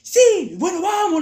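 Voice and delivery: high, loud defiant shout; shouting back. Retold or imitated. Student shouts back at teacher, high defiant yell. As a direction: yell it defiantly, leaping up in pitch. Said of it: "le grité".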